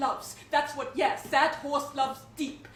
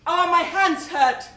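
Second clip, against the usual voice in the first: loud complaint; 3 semitones higher, 6 dB louder than usual. Acted. Cries out about hurting hands.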